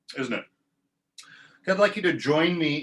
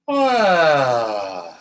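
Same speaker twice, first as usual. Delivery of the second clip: yawn sigh on ah; 8 dB louder than usual. Shown as a demonstration. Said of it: "yawn sigh again on ah".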